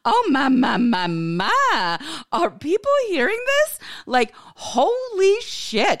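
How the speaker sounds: very high, theatrical dismay; hammed-up scandalized exclaiming; pearl-clutching mock shock. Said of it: "clutching at my pretend pearls".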